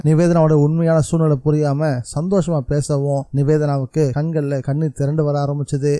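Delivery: ordinary delivery, nothing marked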